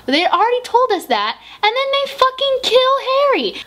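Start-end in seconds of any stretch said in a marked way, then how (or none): 1.6-3.5 emotional outburst. Real, not acted. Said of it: "I'm so emotional about it".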